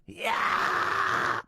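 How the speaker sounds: high breathy "yeah" scream rolling the phlegm; raspy scream "yeah". Shown as a demonstration. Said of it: "kinda try and roll that phlegm".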